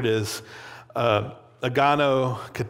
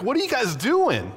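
high-pitched, indignant challenge; angry; 10 semitones higher than usual. Acted. Young David confronting cowering Israelites, acted anger. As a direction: demand it in outraged disbelief. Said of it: "just the anger over it".

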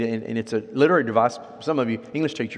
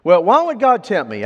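higher, louder protesting question; questioning believer; 7 semitones higher, 7 dB louder than usual. Retold or imitated. Believer asking the pastor about temptation, protesting. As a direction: voice a challenge, pitched up and loud. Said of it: "I've had believers".

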